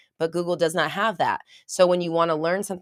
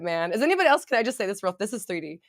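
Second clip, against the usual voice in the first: ordinary talk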